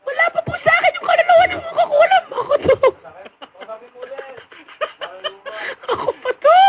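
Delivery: very high squealing protest; frantic mock pleading. Whipped medium pleads with healer, frantic high mock squeal.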